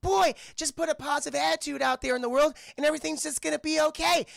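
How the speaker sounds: high, chirpy reindeer voice; Olive. Retold or imitated. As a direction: pitch it up, cheerfully pleased. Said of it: "She's like".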